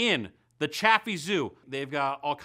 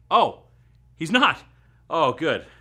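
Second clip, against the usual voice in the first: loud relief; 9 dB louder than usual. Acted. Relieved correction, friend not dead.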